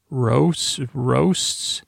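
ROASTS said twice with a rising, questioning tune; puzzled questioning. Real, not acted. Podcaster puzzling over unclear notes, hesitant and questioning. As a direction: softly query "roasts", lifting the end each time.

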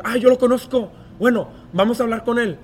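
cita al tío, más alto y fuerte, emocionado; tío entusiasmado. Retold or imitated. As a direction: imita al tío que reconoce el nombre con entusiasmo. (imitando al tío emocionado)

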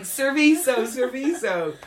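high, loud repeated plea; desperate tourist raising voice and pitch; pleading in Spanish. Retold or imitated. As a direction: push each word at someone who doesn't understand. Repeating Spanish plea to baffled Italians.

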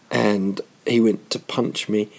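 ordinary talk, nothing marked

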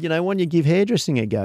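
a quick mock of his old man's idea; imitating his dad. Dad suggests hairdressing to his restless son, lightly imitated. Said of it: "And then he said".

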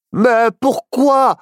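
high, drawn-out protest; high-pitched, slow puzzlement; baffled. Acted. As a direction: stretch it out in bewildered disbelief. Child baffled at being drugged.

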